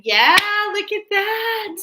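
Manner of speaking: high, loud, slow burst of delight; real thrill at the card she drew; delighted surprise. Real, not acted. Reader gasps at her card to viewers, excited high exclamation.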